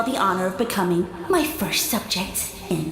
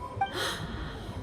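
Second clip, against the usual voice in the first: offended gasp; 13 dB quieter than usual. Acted. Offended at being mocked.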